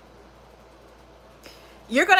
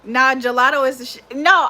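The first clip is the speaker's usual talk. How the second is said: ordinary delivery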